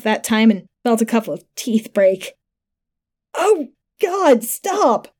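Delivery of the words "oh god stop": high, agonised begging; pained gurgling plea. A narrator's reading. Stabbed man begging his attacker, high gurgled plea. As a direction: beg in pain, pitched way up. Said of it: "Eglin sort of gurgled at me".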